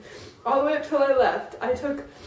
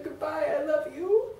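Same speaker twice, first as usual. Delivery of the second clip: tearful goodbye; 3 semitones higher, 4 dB quieter than usual. Retold or imitated. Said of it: "looked back behind me and said".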